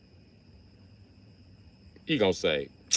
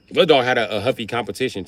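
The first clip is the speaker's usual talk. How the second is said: normal talking voice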